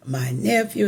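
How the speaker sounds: normal talking voice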